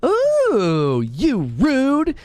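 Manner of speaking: put-on high toddler "Ooh, you rude"; imitating toddler. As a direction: play the little daughter scolding. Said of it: "She'd be like".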